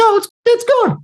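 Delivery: high thin voice, underwhelmed; squeaky letdown. Retold or imitated. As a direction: squeak it out like a disappointed fan. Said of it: "And I was like".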